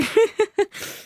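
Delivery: high laugh through tears; relieved laugh while crying; teary laugh. Real, not acted. Laughing through tears after message.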